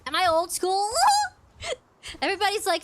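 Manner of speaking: ordinary talk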